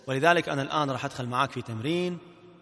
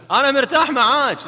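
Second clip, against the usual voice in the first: loud, high, drawn-out drill shout; the wrong, shouted way; 10 semitones higher, 10 dB louder than usual. Shown as a demonstration. Trainer warns husband against entering and saying it so, blaring. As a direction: blare it slowly like a rehearsed slogan. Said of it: "لا، عاد لا تقول كذي".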